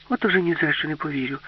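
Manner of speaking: lightly voiced Alice, pitch lifted in disbelief; disbelieving Alice. A narrator's reading.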